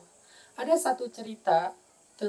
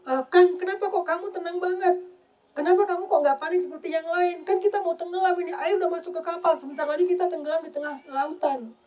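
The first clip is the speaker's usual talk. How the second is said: anxious wife; 7 semitones higher than usual. Retold or imitated. Wife asks husband anxiously.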